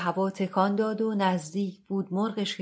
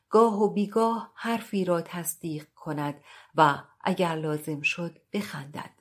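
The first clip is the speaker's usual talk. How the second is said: plain reading voice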